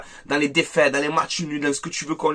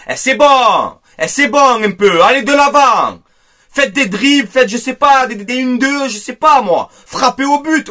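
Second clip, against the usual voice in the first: exasperated shout; 5 semitones higher, 5 dB louder than usual. Real, not acted. Fed-up fan urging the players.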